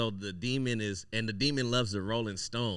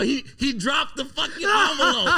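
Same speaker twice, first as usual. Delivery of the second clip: much higher and louder, laughing; excited laughing; 10 dB louder than usual. Retold or imitated. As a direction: tell it bursting with glee. Thrilled retelling of the encounter.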